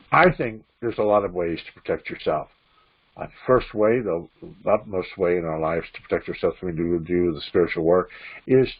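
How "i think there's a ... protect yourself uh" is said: usual speaking voice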